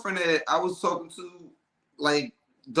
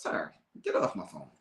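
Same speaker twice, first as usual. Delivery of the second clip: low, dismissive send-off; 12 semitones lower, 8 dB quieter than usual. Retold or imitated. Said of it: "hanging up on a caller".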